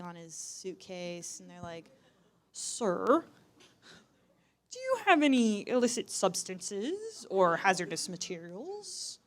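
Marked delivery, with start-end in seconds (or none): 2.5-9.2 airport security officer. Acted. Voicing airport security questioning dad.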